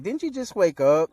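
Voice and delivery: high, loud incredulous question; play-acted disbelief at a high pitch; mock bewilderment. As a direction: imitate a blurted, puzzled objection. Goku acted, puzzled question.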